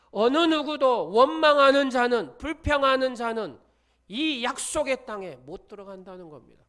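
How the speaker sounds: high, loud preaching warning; preaching at full force. Real, not acted. Pastor warns the grumblers, loud and high.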